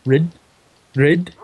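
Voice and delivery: Rid said with a short i sound; careful corrected Rid. Shown as a demonstration. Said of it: "you say a Y like an I".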